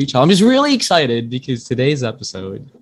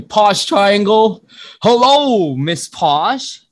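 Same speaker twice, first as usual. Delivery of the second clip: hyped introduction; 7 semitones higher, much slower than usual. Acted. Host announcing his guest.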